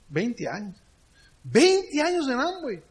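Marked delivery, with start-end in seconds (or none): 1.4-2.8 high, drawn-out disbelief; astonished echo. Retold or imitated. Uber driver amazed at twenty years, astonished echo. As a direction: repeat it back amazed, pitch leaping up.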